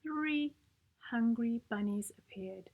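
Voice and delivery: plain reading voice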